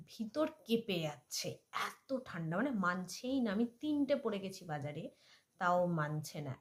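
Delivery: ordinary talk, nothing marked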